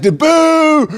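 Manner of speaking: very high, loud boo; imitated booing. Heckler beside her giggling man, very loud high boo. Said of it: "And she was like".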